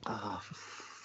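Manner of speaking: breathy whisper, cut off; whispered curse. Real, not acted. Whispering a curse at the cohost's bad fact. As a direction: swallow the swear under your breath.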